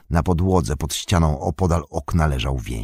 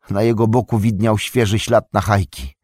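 usual speaking voice

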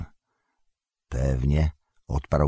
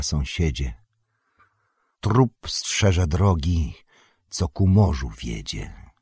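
a measured, ceremonial poem reading; solemn recited verse. A narrator's reading. Recited verse message from Galadriel.

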